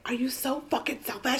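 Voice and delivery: high but soft scolding quote; mock berating. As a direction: berate her in a hushed, acted way. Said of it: "He's berating her".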